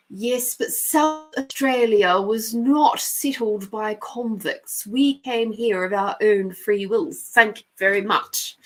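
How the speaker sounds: slow, indignant mock-proper voice; hammed-up prim correction; proper South Australian. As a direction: draw it out with haughty local pride. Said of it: "The culture here is".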